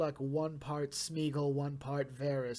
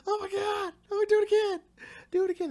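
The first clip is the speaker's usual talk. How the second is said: much higher, louder, gushing fangirl squeal; squealing excitement; 8 dB louder than usual. Retold or imitated. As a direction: squeal it like a thrilled fan who can't contain it. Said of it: "this movie had me going".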